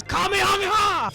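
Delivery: high-pitched special move call; anime attack shout. Pretend. Anime attack callout in game.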